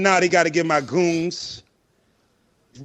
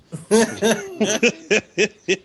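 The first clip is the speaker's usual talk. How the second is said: hearty laugh; 4 semitones higher than usual. Real, not acted. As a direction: burst out laughing. Laughing at a joke.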